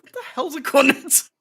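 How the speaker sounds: puzzled American. Retold or imitated. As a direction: play the confused onlooker. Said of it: "they just look at me like".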